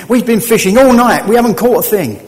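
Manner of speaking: loud, high complaint after a fruitless night; exasperated fishermen. Acted. Fishermen complaining to Jesus after empty nets, loud and high. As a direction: belt it out like tired fishermen.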